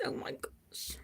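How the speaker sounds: whispered, choked-up oh my gosh; a real awed whisper, no voice; breathless whisper. Real, not acted. Overcome by a friend's gift, a real whisper.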